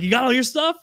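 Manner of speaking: happy with his loaded cart; imitating Andy. Andy at liquor store.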